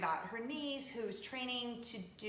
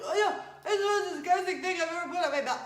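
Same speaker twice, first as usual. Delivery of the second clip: louder, higher hammed-up disgust; over-the-top disgust; 8 semitones higher, 9 dB louder than usual. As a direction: ham up the horror of the taste. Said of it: "I'm like".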